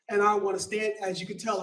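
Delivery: ordinary delivery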